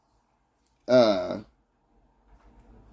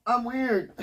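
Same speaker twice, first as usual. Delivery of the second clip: high self-mocking; 12 semitones higher than usual. Real, not acted.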